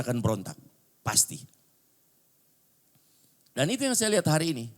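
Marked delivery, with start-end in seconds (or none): none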